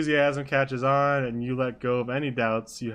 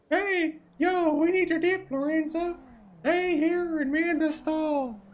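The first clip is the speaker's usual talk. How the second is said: very high falsetto, eager sidekick; high-pitched character chatter; Flop's high voice; 3 dB louder, slower than usual. Acted. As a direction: pipe it up in a put-on high voice. High voice for narration and Flop.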